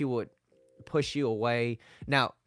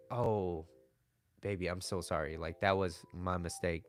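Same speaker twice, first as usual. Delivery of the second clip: softer and lower, gentle sorry; tender apology; 6 semitones lower, 6 dB quieter than usual. Acted. Boyfriend soothing his upset girlfriend, soft tender apology. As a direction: soothe her, own the mistake.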